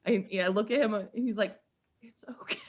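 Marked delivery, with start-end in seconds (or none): 2.0-2.6 soft, hushed reassurance; imitated soothing. Football star to crying fan, softly soothing.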